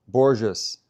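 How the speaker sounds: Borges as BOR-zhuss, firm falling tone; Borges with zh as in "measure", settled; pronounced with J. Shown as a demonstration. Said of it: "With J".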